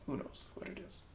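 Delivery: a hushed, wondering whisper. Real, not acted. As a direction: whisper "Who knows what it is?" softly. Musing aloud, then whispered repeat.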